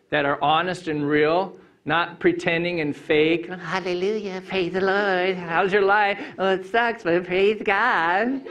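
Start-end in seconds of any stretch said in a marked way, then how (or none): 3.5-6.2 fake pious greeting. Mocking fake churchy greeting. 6.4-8.4 fake pious reply. Mocking fake churchy reply.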